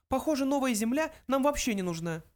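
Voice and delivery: high, small mouse voice; mouse interjecting. A narrator's reading. Mouse interrupts talk of fjords, high voice. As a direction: cut in with a high little voice. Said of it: "вмешался Фрэнки".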